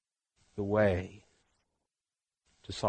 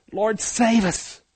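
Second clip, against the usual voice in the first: high, loud, drawn-out plea; upset, high-pitched cry for help; panicked hollering; 11 semitones higher, 7 dB louder, slower than usual. Acted. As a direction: holler slowly and desperately for rescue. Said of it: "panicking, hollering, screaming, upset".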